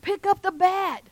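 high, drawn-out call across the field; loud yell. Acted. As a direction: shout it to Carl from the stands. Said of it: "somebody yells real loud".

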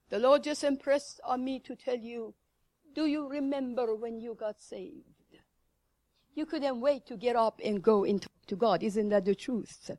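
ordinary delivery

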